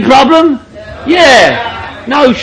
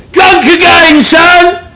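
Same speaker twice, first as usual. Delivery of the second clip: higher mock yell up the stairs; a retold mum calling out, pitched up high; 7 semitones higher, slower than usual. Mum yelling down the stairs at drunk son, high mock yell. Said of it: "She would yell out down the stairs".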